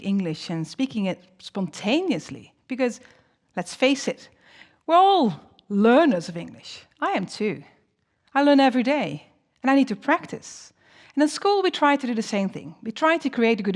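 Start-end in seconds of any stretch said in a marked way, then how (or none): none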